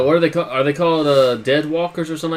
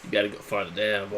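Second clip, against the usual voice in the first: much lower, softer mock deep voice; 6 semitones lower, 11 dB quieter than usual. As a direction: drop it way down. Mocks the deep voice.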